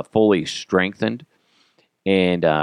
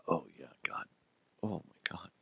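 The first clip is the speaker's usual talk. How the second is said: very soft, near-voiceless muttering; barely audible queasy aside; disgusted whisper; 2 semitones lower, 18 dB quieter than usual. Real, not acted. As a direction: whisper it to yourself in revulsion. Revolted by his own story.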